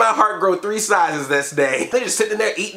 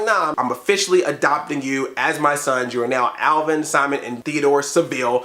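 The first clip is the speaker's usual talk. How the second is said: lower, solemn fatherly pronouncement; deep Dave voice; 7 semitones lower than usual. Retold or imitated. Reviewer voicing Dave adopting the chipmunks, low and solemn. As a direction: drop the voice and announce it gravely. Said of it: "He's like".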